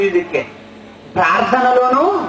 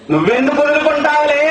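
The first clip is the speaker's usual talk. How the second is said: sarcastic; 6 semitones higher than usual. Retold or imitated. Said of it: "ఎటకారమా".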